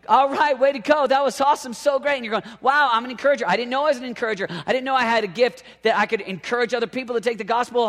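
high, loud, driving sermon delivery; impassioned preaching. Acted. Preaches at full force.

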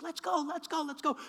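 high, put-on woman's voice, repeated urging. Woman hurries the mugger away from his victim, high urging. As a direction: mimic a woman hurrying someone along.

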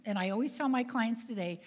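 usual speaking voice

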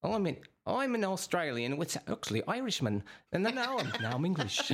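high, laughing, shifting bad accent; a mock accent sliding from Australian to Irish to English; fake accent. Teasing cohost's accents that drift, high fake accent. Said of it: "I love your fake accents".

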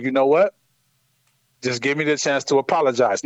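low, measured, placating; retold calm, conciliatory appeal. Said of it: "in a calm voice".

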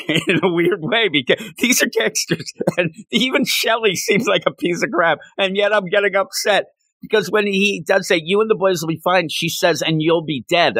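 real choked-up voice, high, with an embarrassed laugh. Real, not acted. Narrator upset over gangsters' goodbye, choked up. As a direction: pitch rises as you laugh through getting emotional. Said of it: "I'm actually getting a little choked up here".

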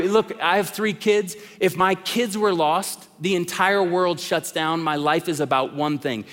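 usual speaking voice

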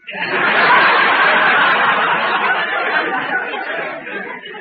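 breathy wheezing guffaw, loud; hammed-up wheezy laugh. Laughs at own pun.

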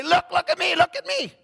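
high mock boastful look at me; mock showing off. Preacher mocking a boastful woman, high showy voice. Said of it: "exert ourselves and say".

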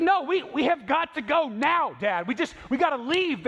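a dad imitating his panicking daughter; panicked daughter. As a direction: play the frightened kid begging to leave. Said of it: "It's like".

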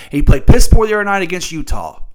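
ordinary talk, nothing marked

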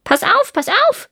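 high squawking crow voice; raspy cawing crow. Acted. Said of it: "sagte die Krähe".

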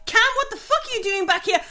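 high-pitched, irritated scolding; angry shouting. Acted. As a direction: tear into a slacking crewmate. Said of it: "she just shouts at you".

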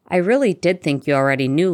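ordinary delivery, nothing marked

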